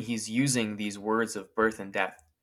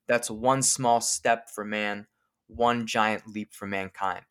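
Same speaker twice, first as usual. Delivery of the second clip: slow quote; slower than usual. Shown as a demonstration. Quoting Armstrong, slowed.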